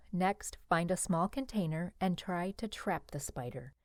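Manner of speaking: ordinary delivery, nothing marked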